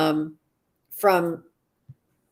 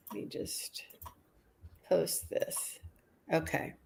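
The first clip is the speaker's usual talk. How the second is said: whisper; 13 dB quieter than usual. Real, not acted. Host aside while posting online.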